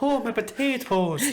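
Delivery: high, drawn-out mock Irish lament; cartoon Irish wail; exaggerated Irish accent. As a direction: stretch it in a silly high Irish brogue. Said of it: "He's got an accent".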